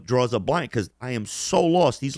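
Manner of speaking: ordinary talk, nothing marked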